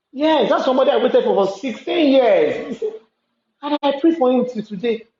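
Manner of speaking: a woman laughing about the man she waited for; rueful laugh. Real, not acted. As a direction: laugh it off while admitting the pain. Laughs recalling lost love.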